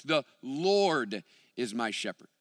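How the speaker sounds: slow, weighty LORD; stress on LORD. Shown as a demonstration. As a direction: LORD drawn out slowly, the rest falling away. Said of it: "putting emphasis on different words".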